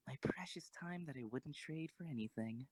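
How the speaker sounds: whispered private thought. Acted. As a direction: hush it like a secret to yourself.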